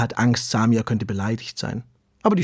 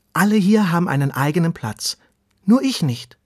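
put-on higher, pleading young worm voice; eager little earthworm; 7 semitones higher, 5 dB louder than usual. Earthworm Ronny tells the tortoise he has no place. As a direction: plead like a small worm left out.

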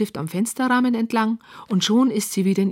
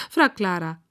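usual speaking voice

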